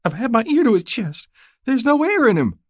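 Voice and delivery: very high, upset country woman; distraught new widow. A narrator's reading. Young widow assures rider her man is dead, distraught.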